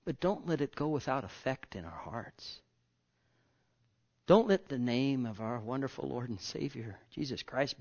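ordinary talk

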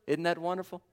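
usual speaking voice

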